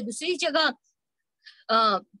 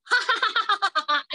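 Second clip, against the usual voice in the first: open-mouthed guffaw shown to class; demo belly laugh; 4 semitones higher than usual. Shown as a demonstration. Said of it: "ऐसे देखो, ऐसा मुंह फाड़ते हैं". (ठहाका लगाकर जोर से हँसना)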